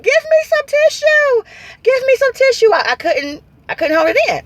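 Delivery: high, wailing imitation of crying; tearful crying. Patient begging doctor for tissue during injections, mock-crying. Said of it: "I'm in there crying".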